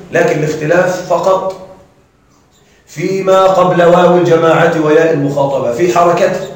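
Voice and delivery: ordinary delivery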